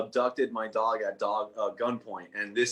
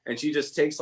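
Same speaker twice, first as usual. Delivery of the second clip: usual speaking voice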